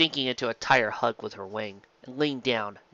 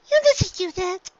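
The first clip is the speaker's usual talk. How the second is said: high filly voice, wondering; a filly amazed; young filly Dinky; 6 dB louder than usual. A narrator's reading. Filly wondering to herself, high.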